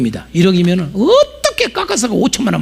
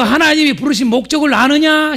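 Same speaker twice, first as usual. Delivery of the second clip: proclaiming call; 8 semitones higher than usual. Shown as a demonstration. Preacher proclaiming to the church, ringing and high. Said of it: "교회여".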